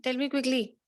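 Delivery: ordinary talk, nothing marked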